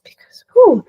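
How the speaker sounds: loud high whew of relief; sharp loud sigh of relief; whew exclamation. Real, not acted. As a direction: blow out a big whew.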